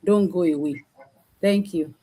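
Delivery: ordinary talk, nothing marked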